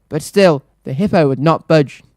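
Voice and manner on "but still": ordinary delivery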